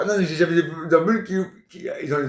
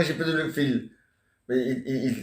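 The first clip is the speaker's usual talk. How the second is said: normal talking voice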